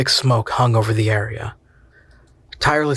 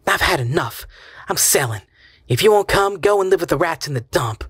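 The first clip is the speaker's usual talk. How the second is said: bitter; 6 semitones higher than usual. Acted. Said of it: "Her voice was bitter".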